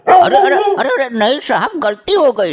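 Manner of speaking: panicked pleading. Acted. Prisoner panics at angry inspector.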